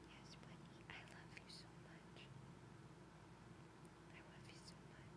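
tender whisper to a pet; whisper to the dog. Real, not acted. Owner whispering to her dog, a tender whisper. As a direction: softly whisper love to the dog.

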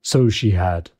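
put-on low-pitched old miser's reply; Scrooge. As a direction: answer the spirit in a low character voice. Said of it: "cried Scrooge".

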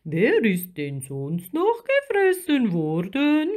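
big swooping creature voice; curious giant octopus. A narrator's reading. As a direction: inquisitive sea giant with stretched vowels. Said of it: "fragte der Riesenkrake neugierig".

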